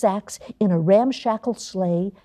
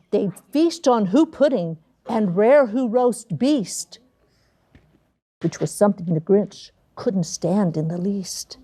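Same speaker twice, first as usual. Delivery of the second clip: usual speaking voice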